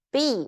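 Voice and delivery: "B" falling steeply; fourth tone. Shown as a demonstration. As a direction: drop the pitch through "B". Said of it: "how the fourth tone sound like".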